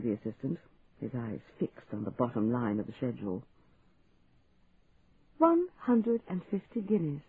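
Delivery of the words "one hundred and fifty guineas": slow, higher, feigned astonishment; mock disbelief. A narrator's reading. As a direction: drag it out as if shocked. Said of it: "repeated in mock disbelief".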